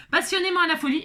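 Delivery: fast, laughing read of the label. Real, not acted. Teacher laughing as she reads her wheel labels, fast and amused. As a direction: giggle through the words.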